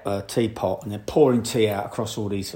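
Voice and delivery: ordinary talk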